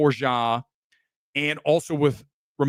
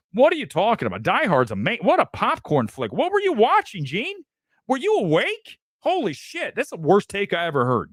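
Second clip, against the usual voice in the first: mock outrage; 7 semitones higher than usual. Mock argument with Gene over Die Hard.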